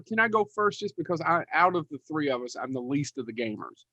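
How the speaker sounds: ordinary delivery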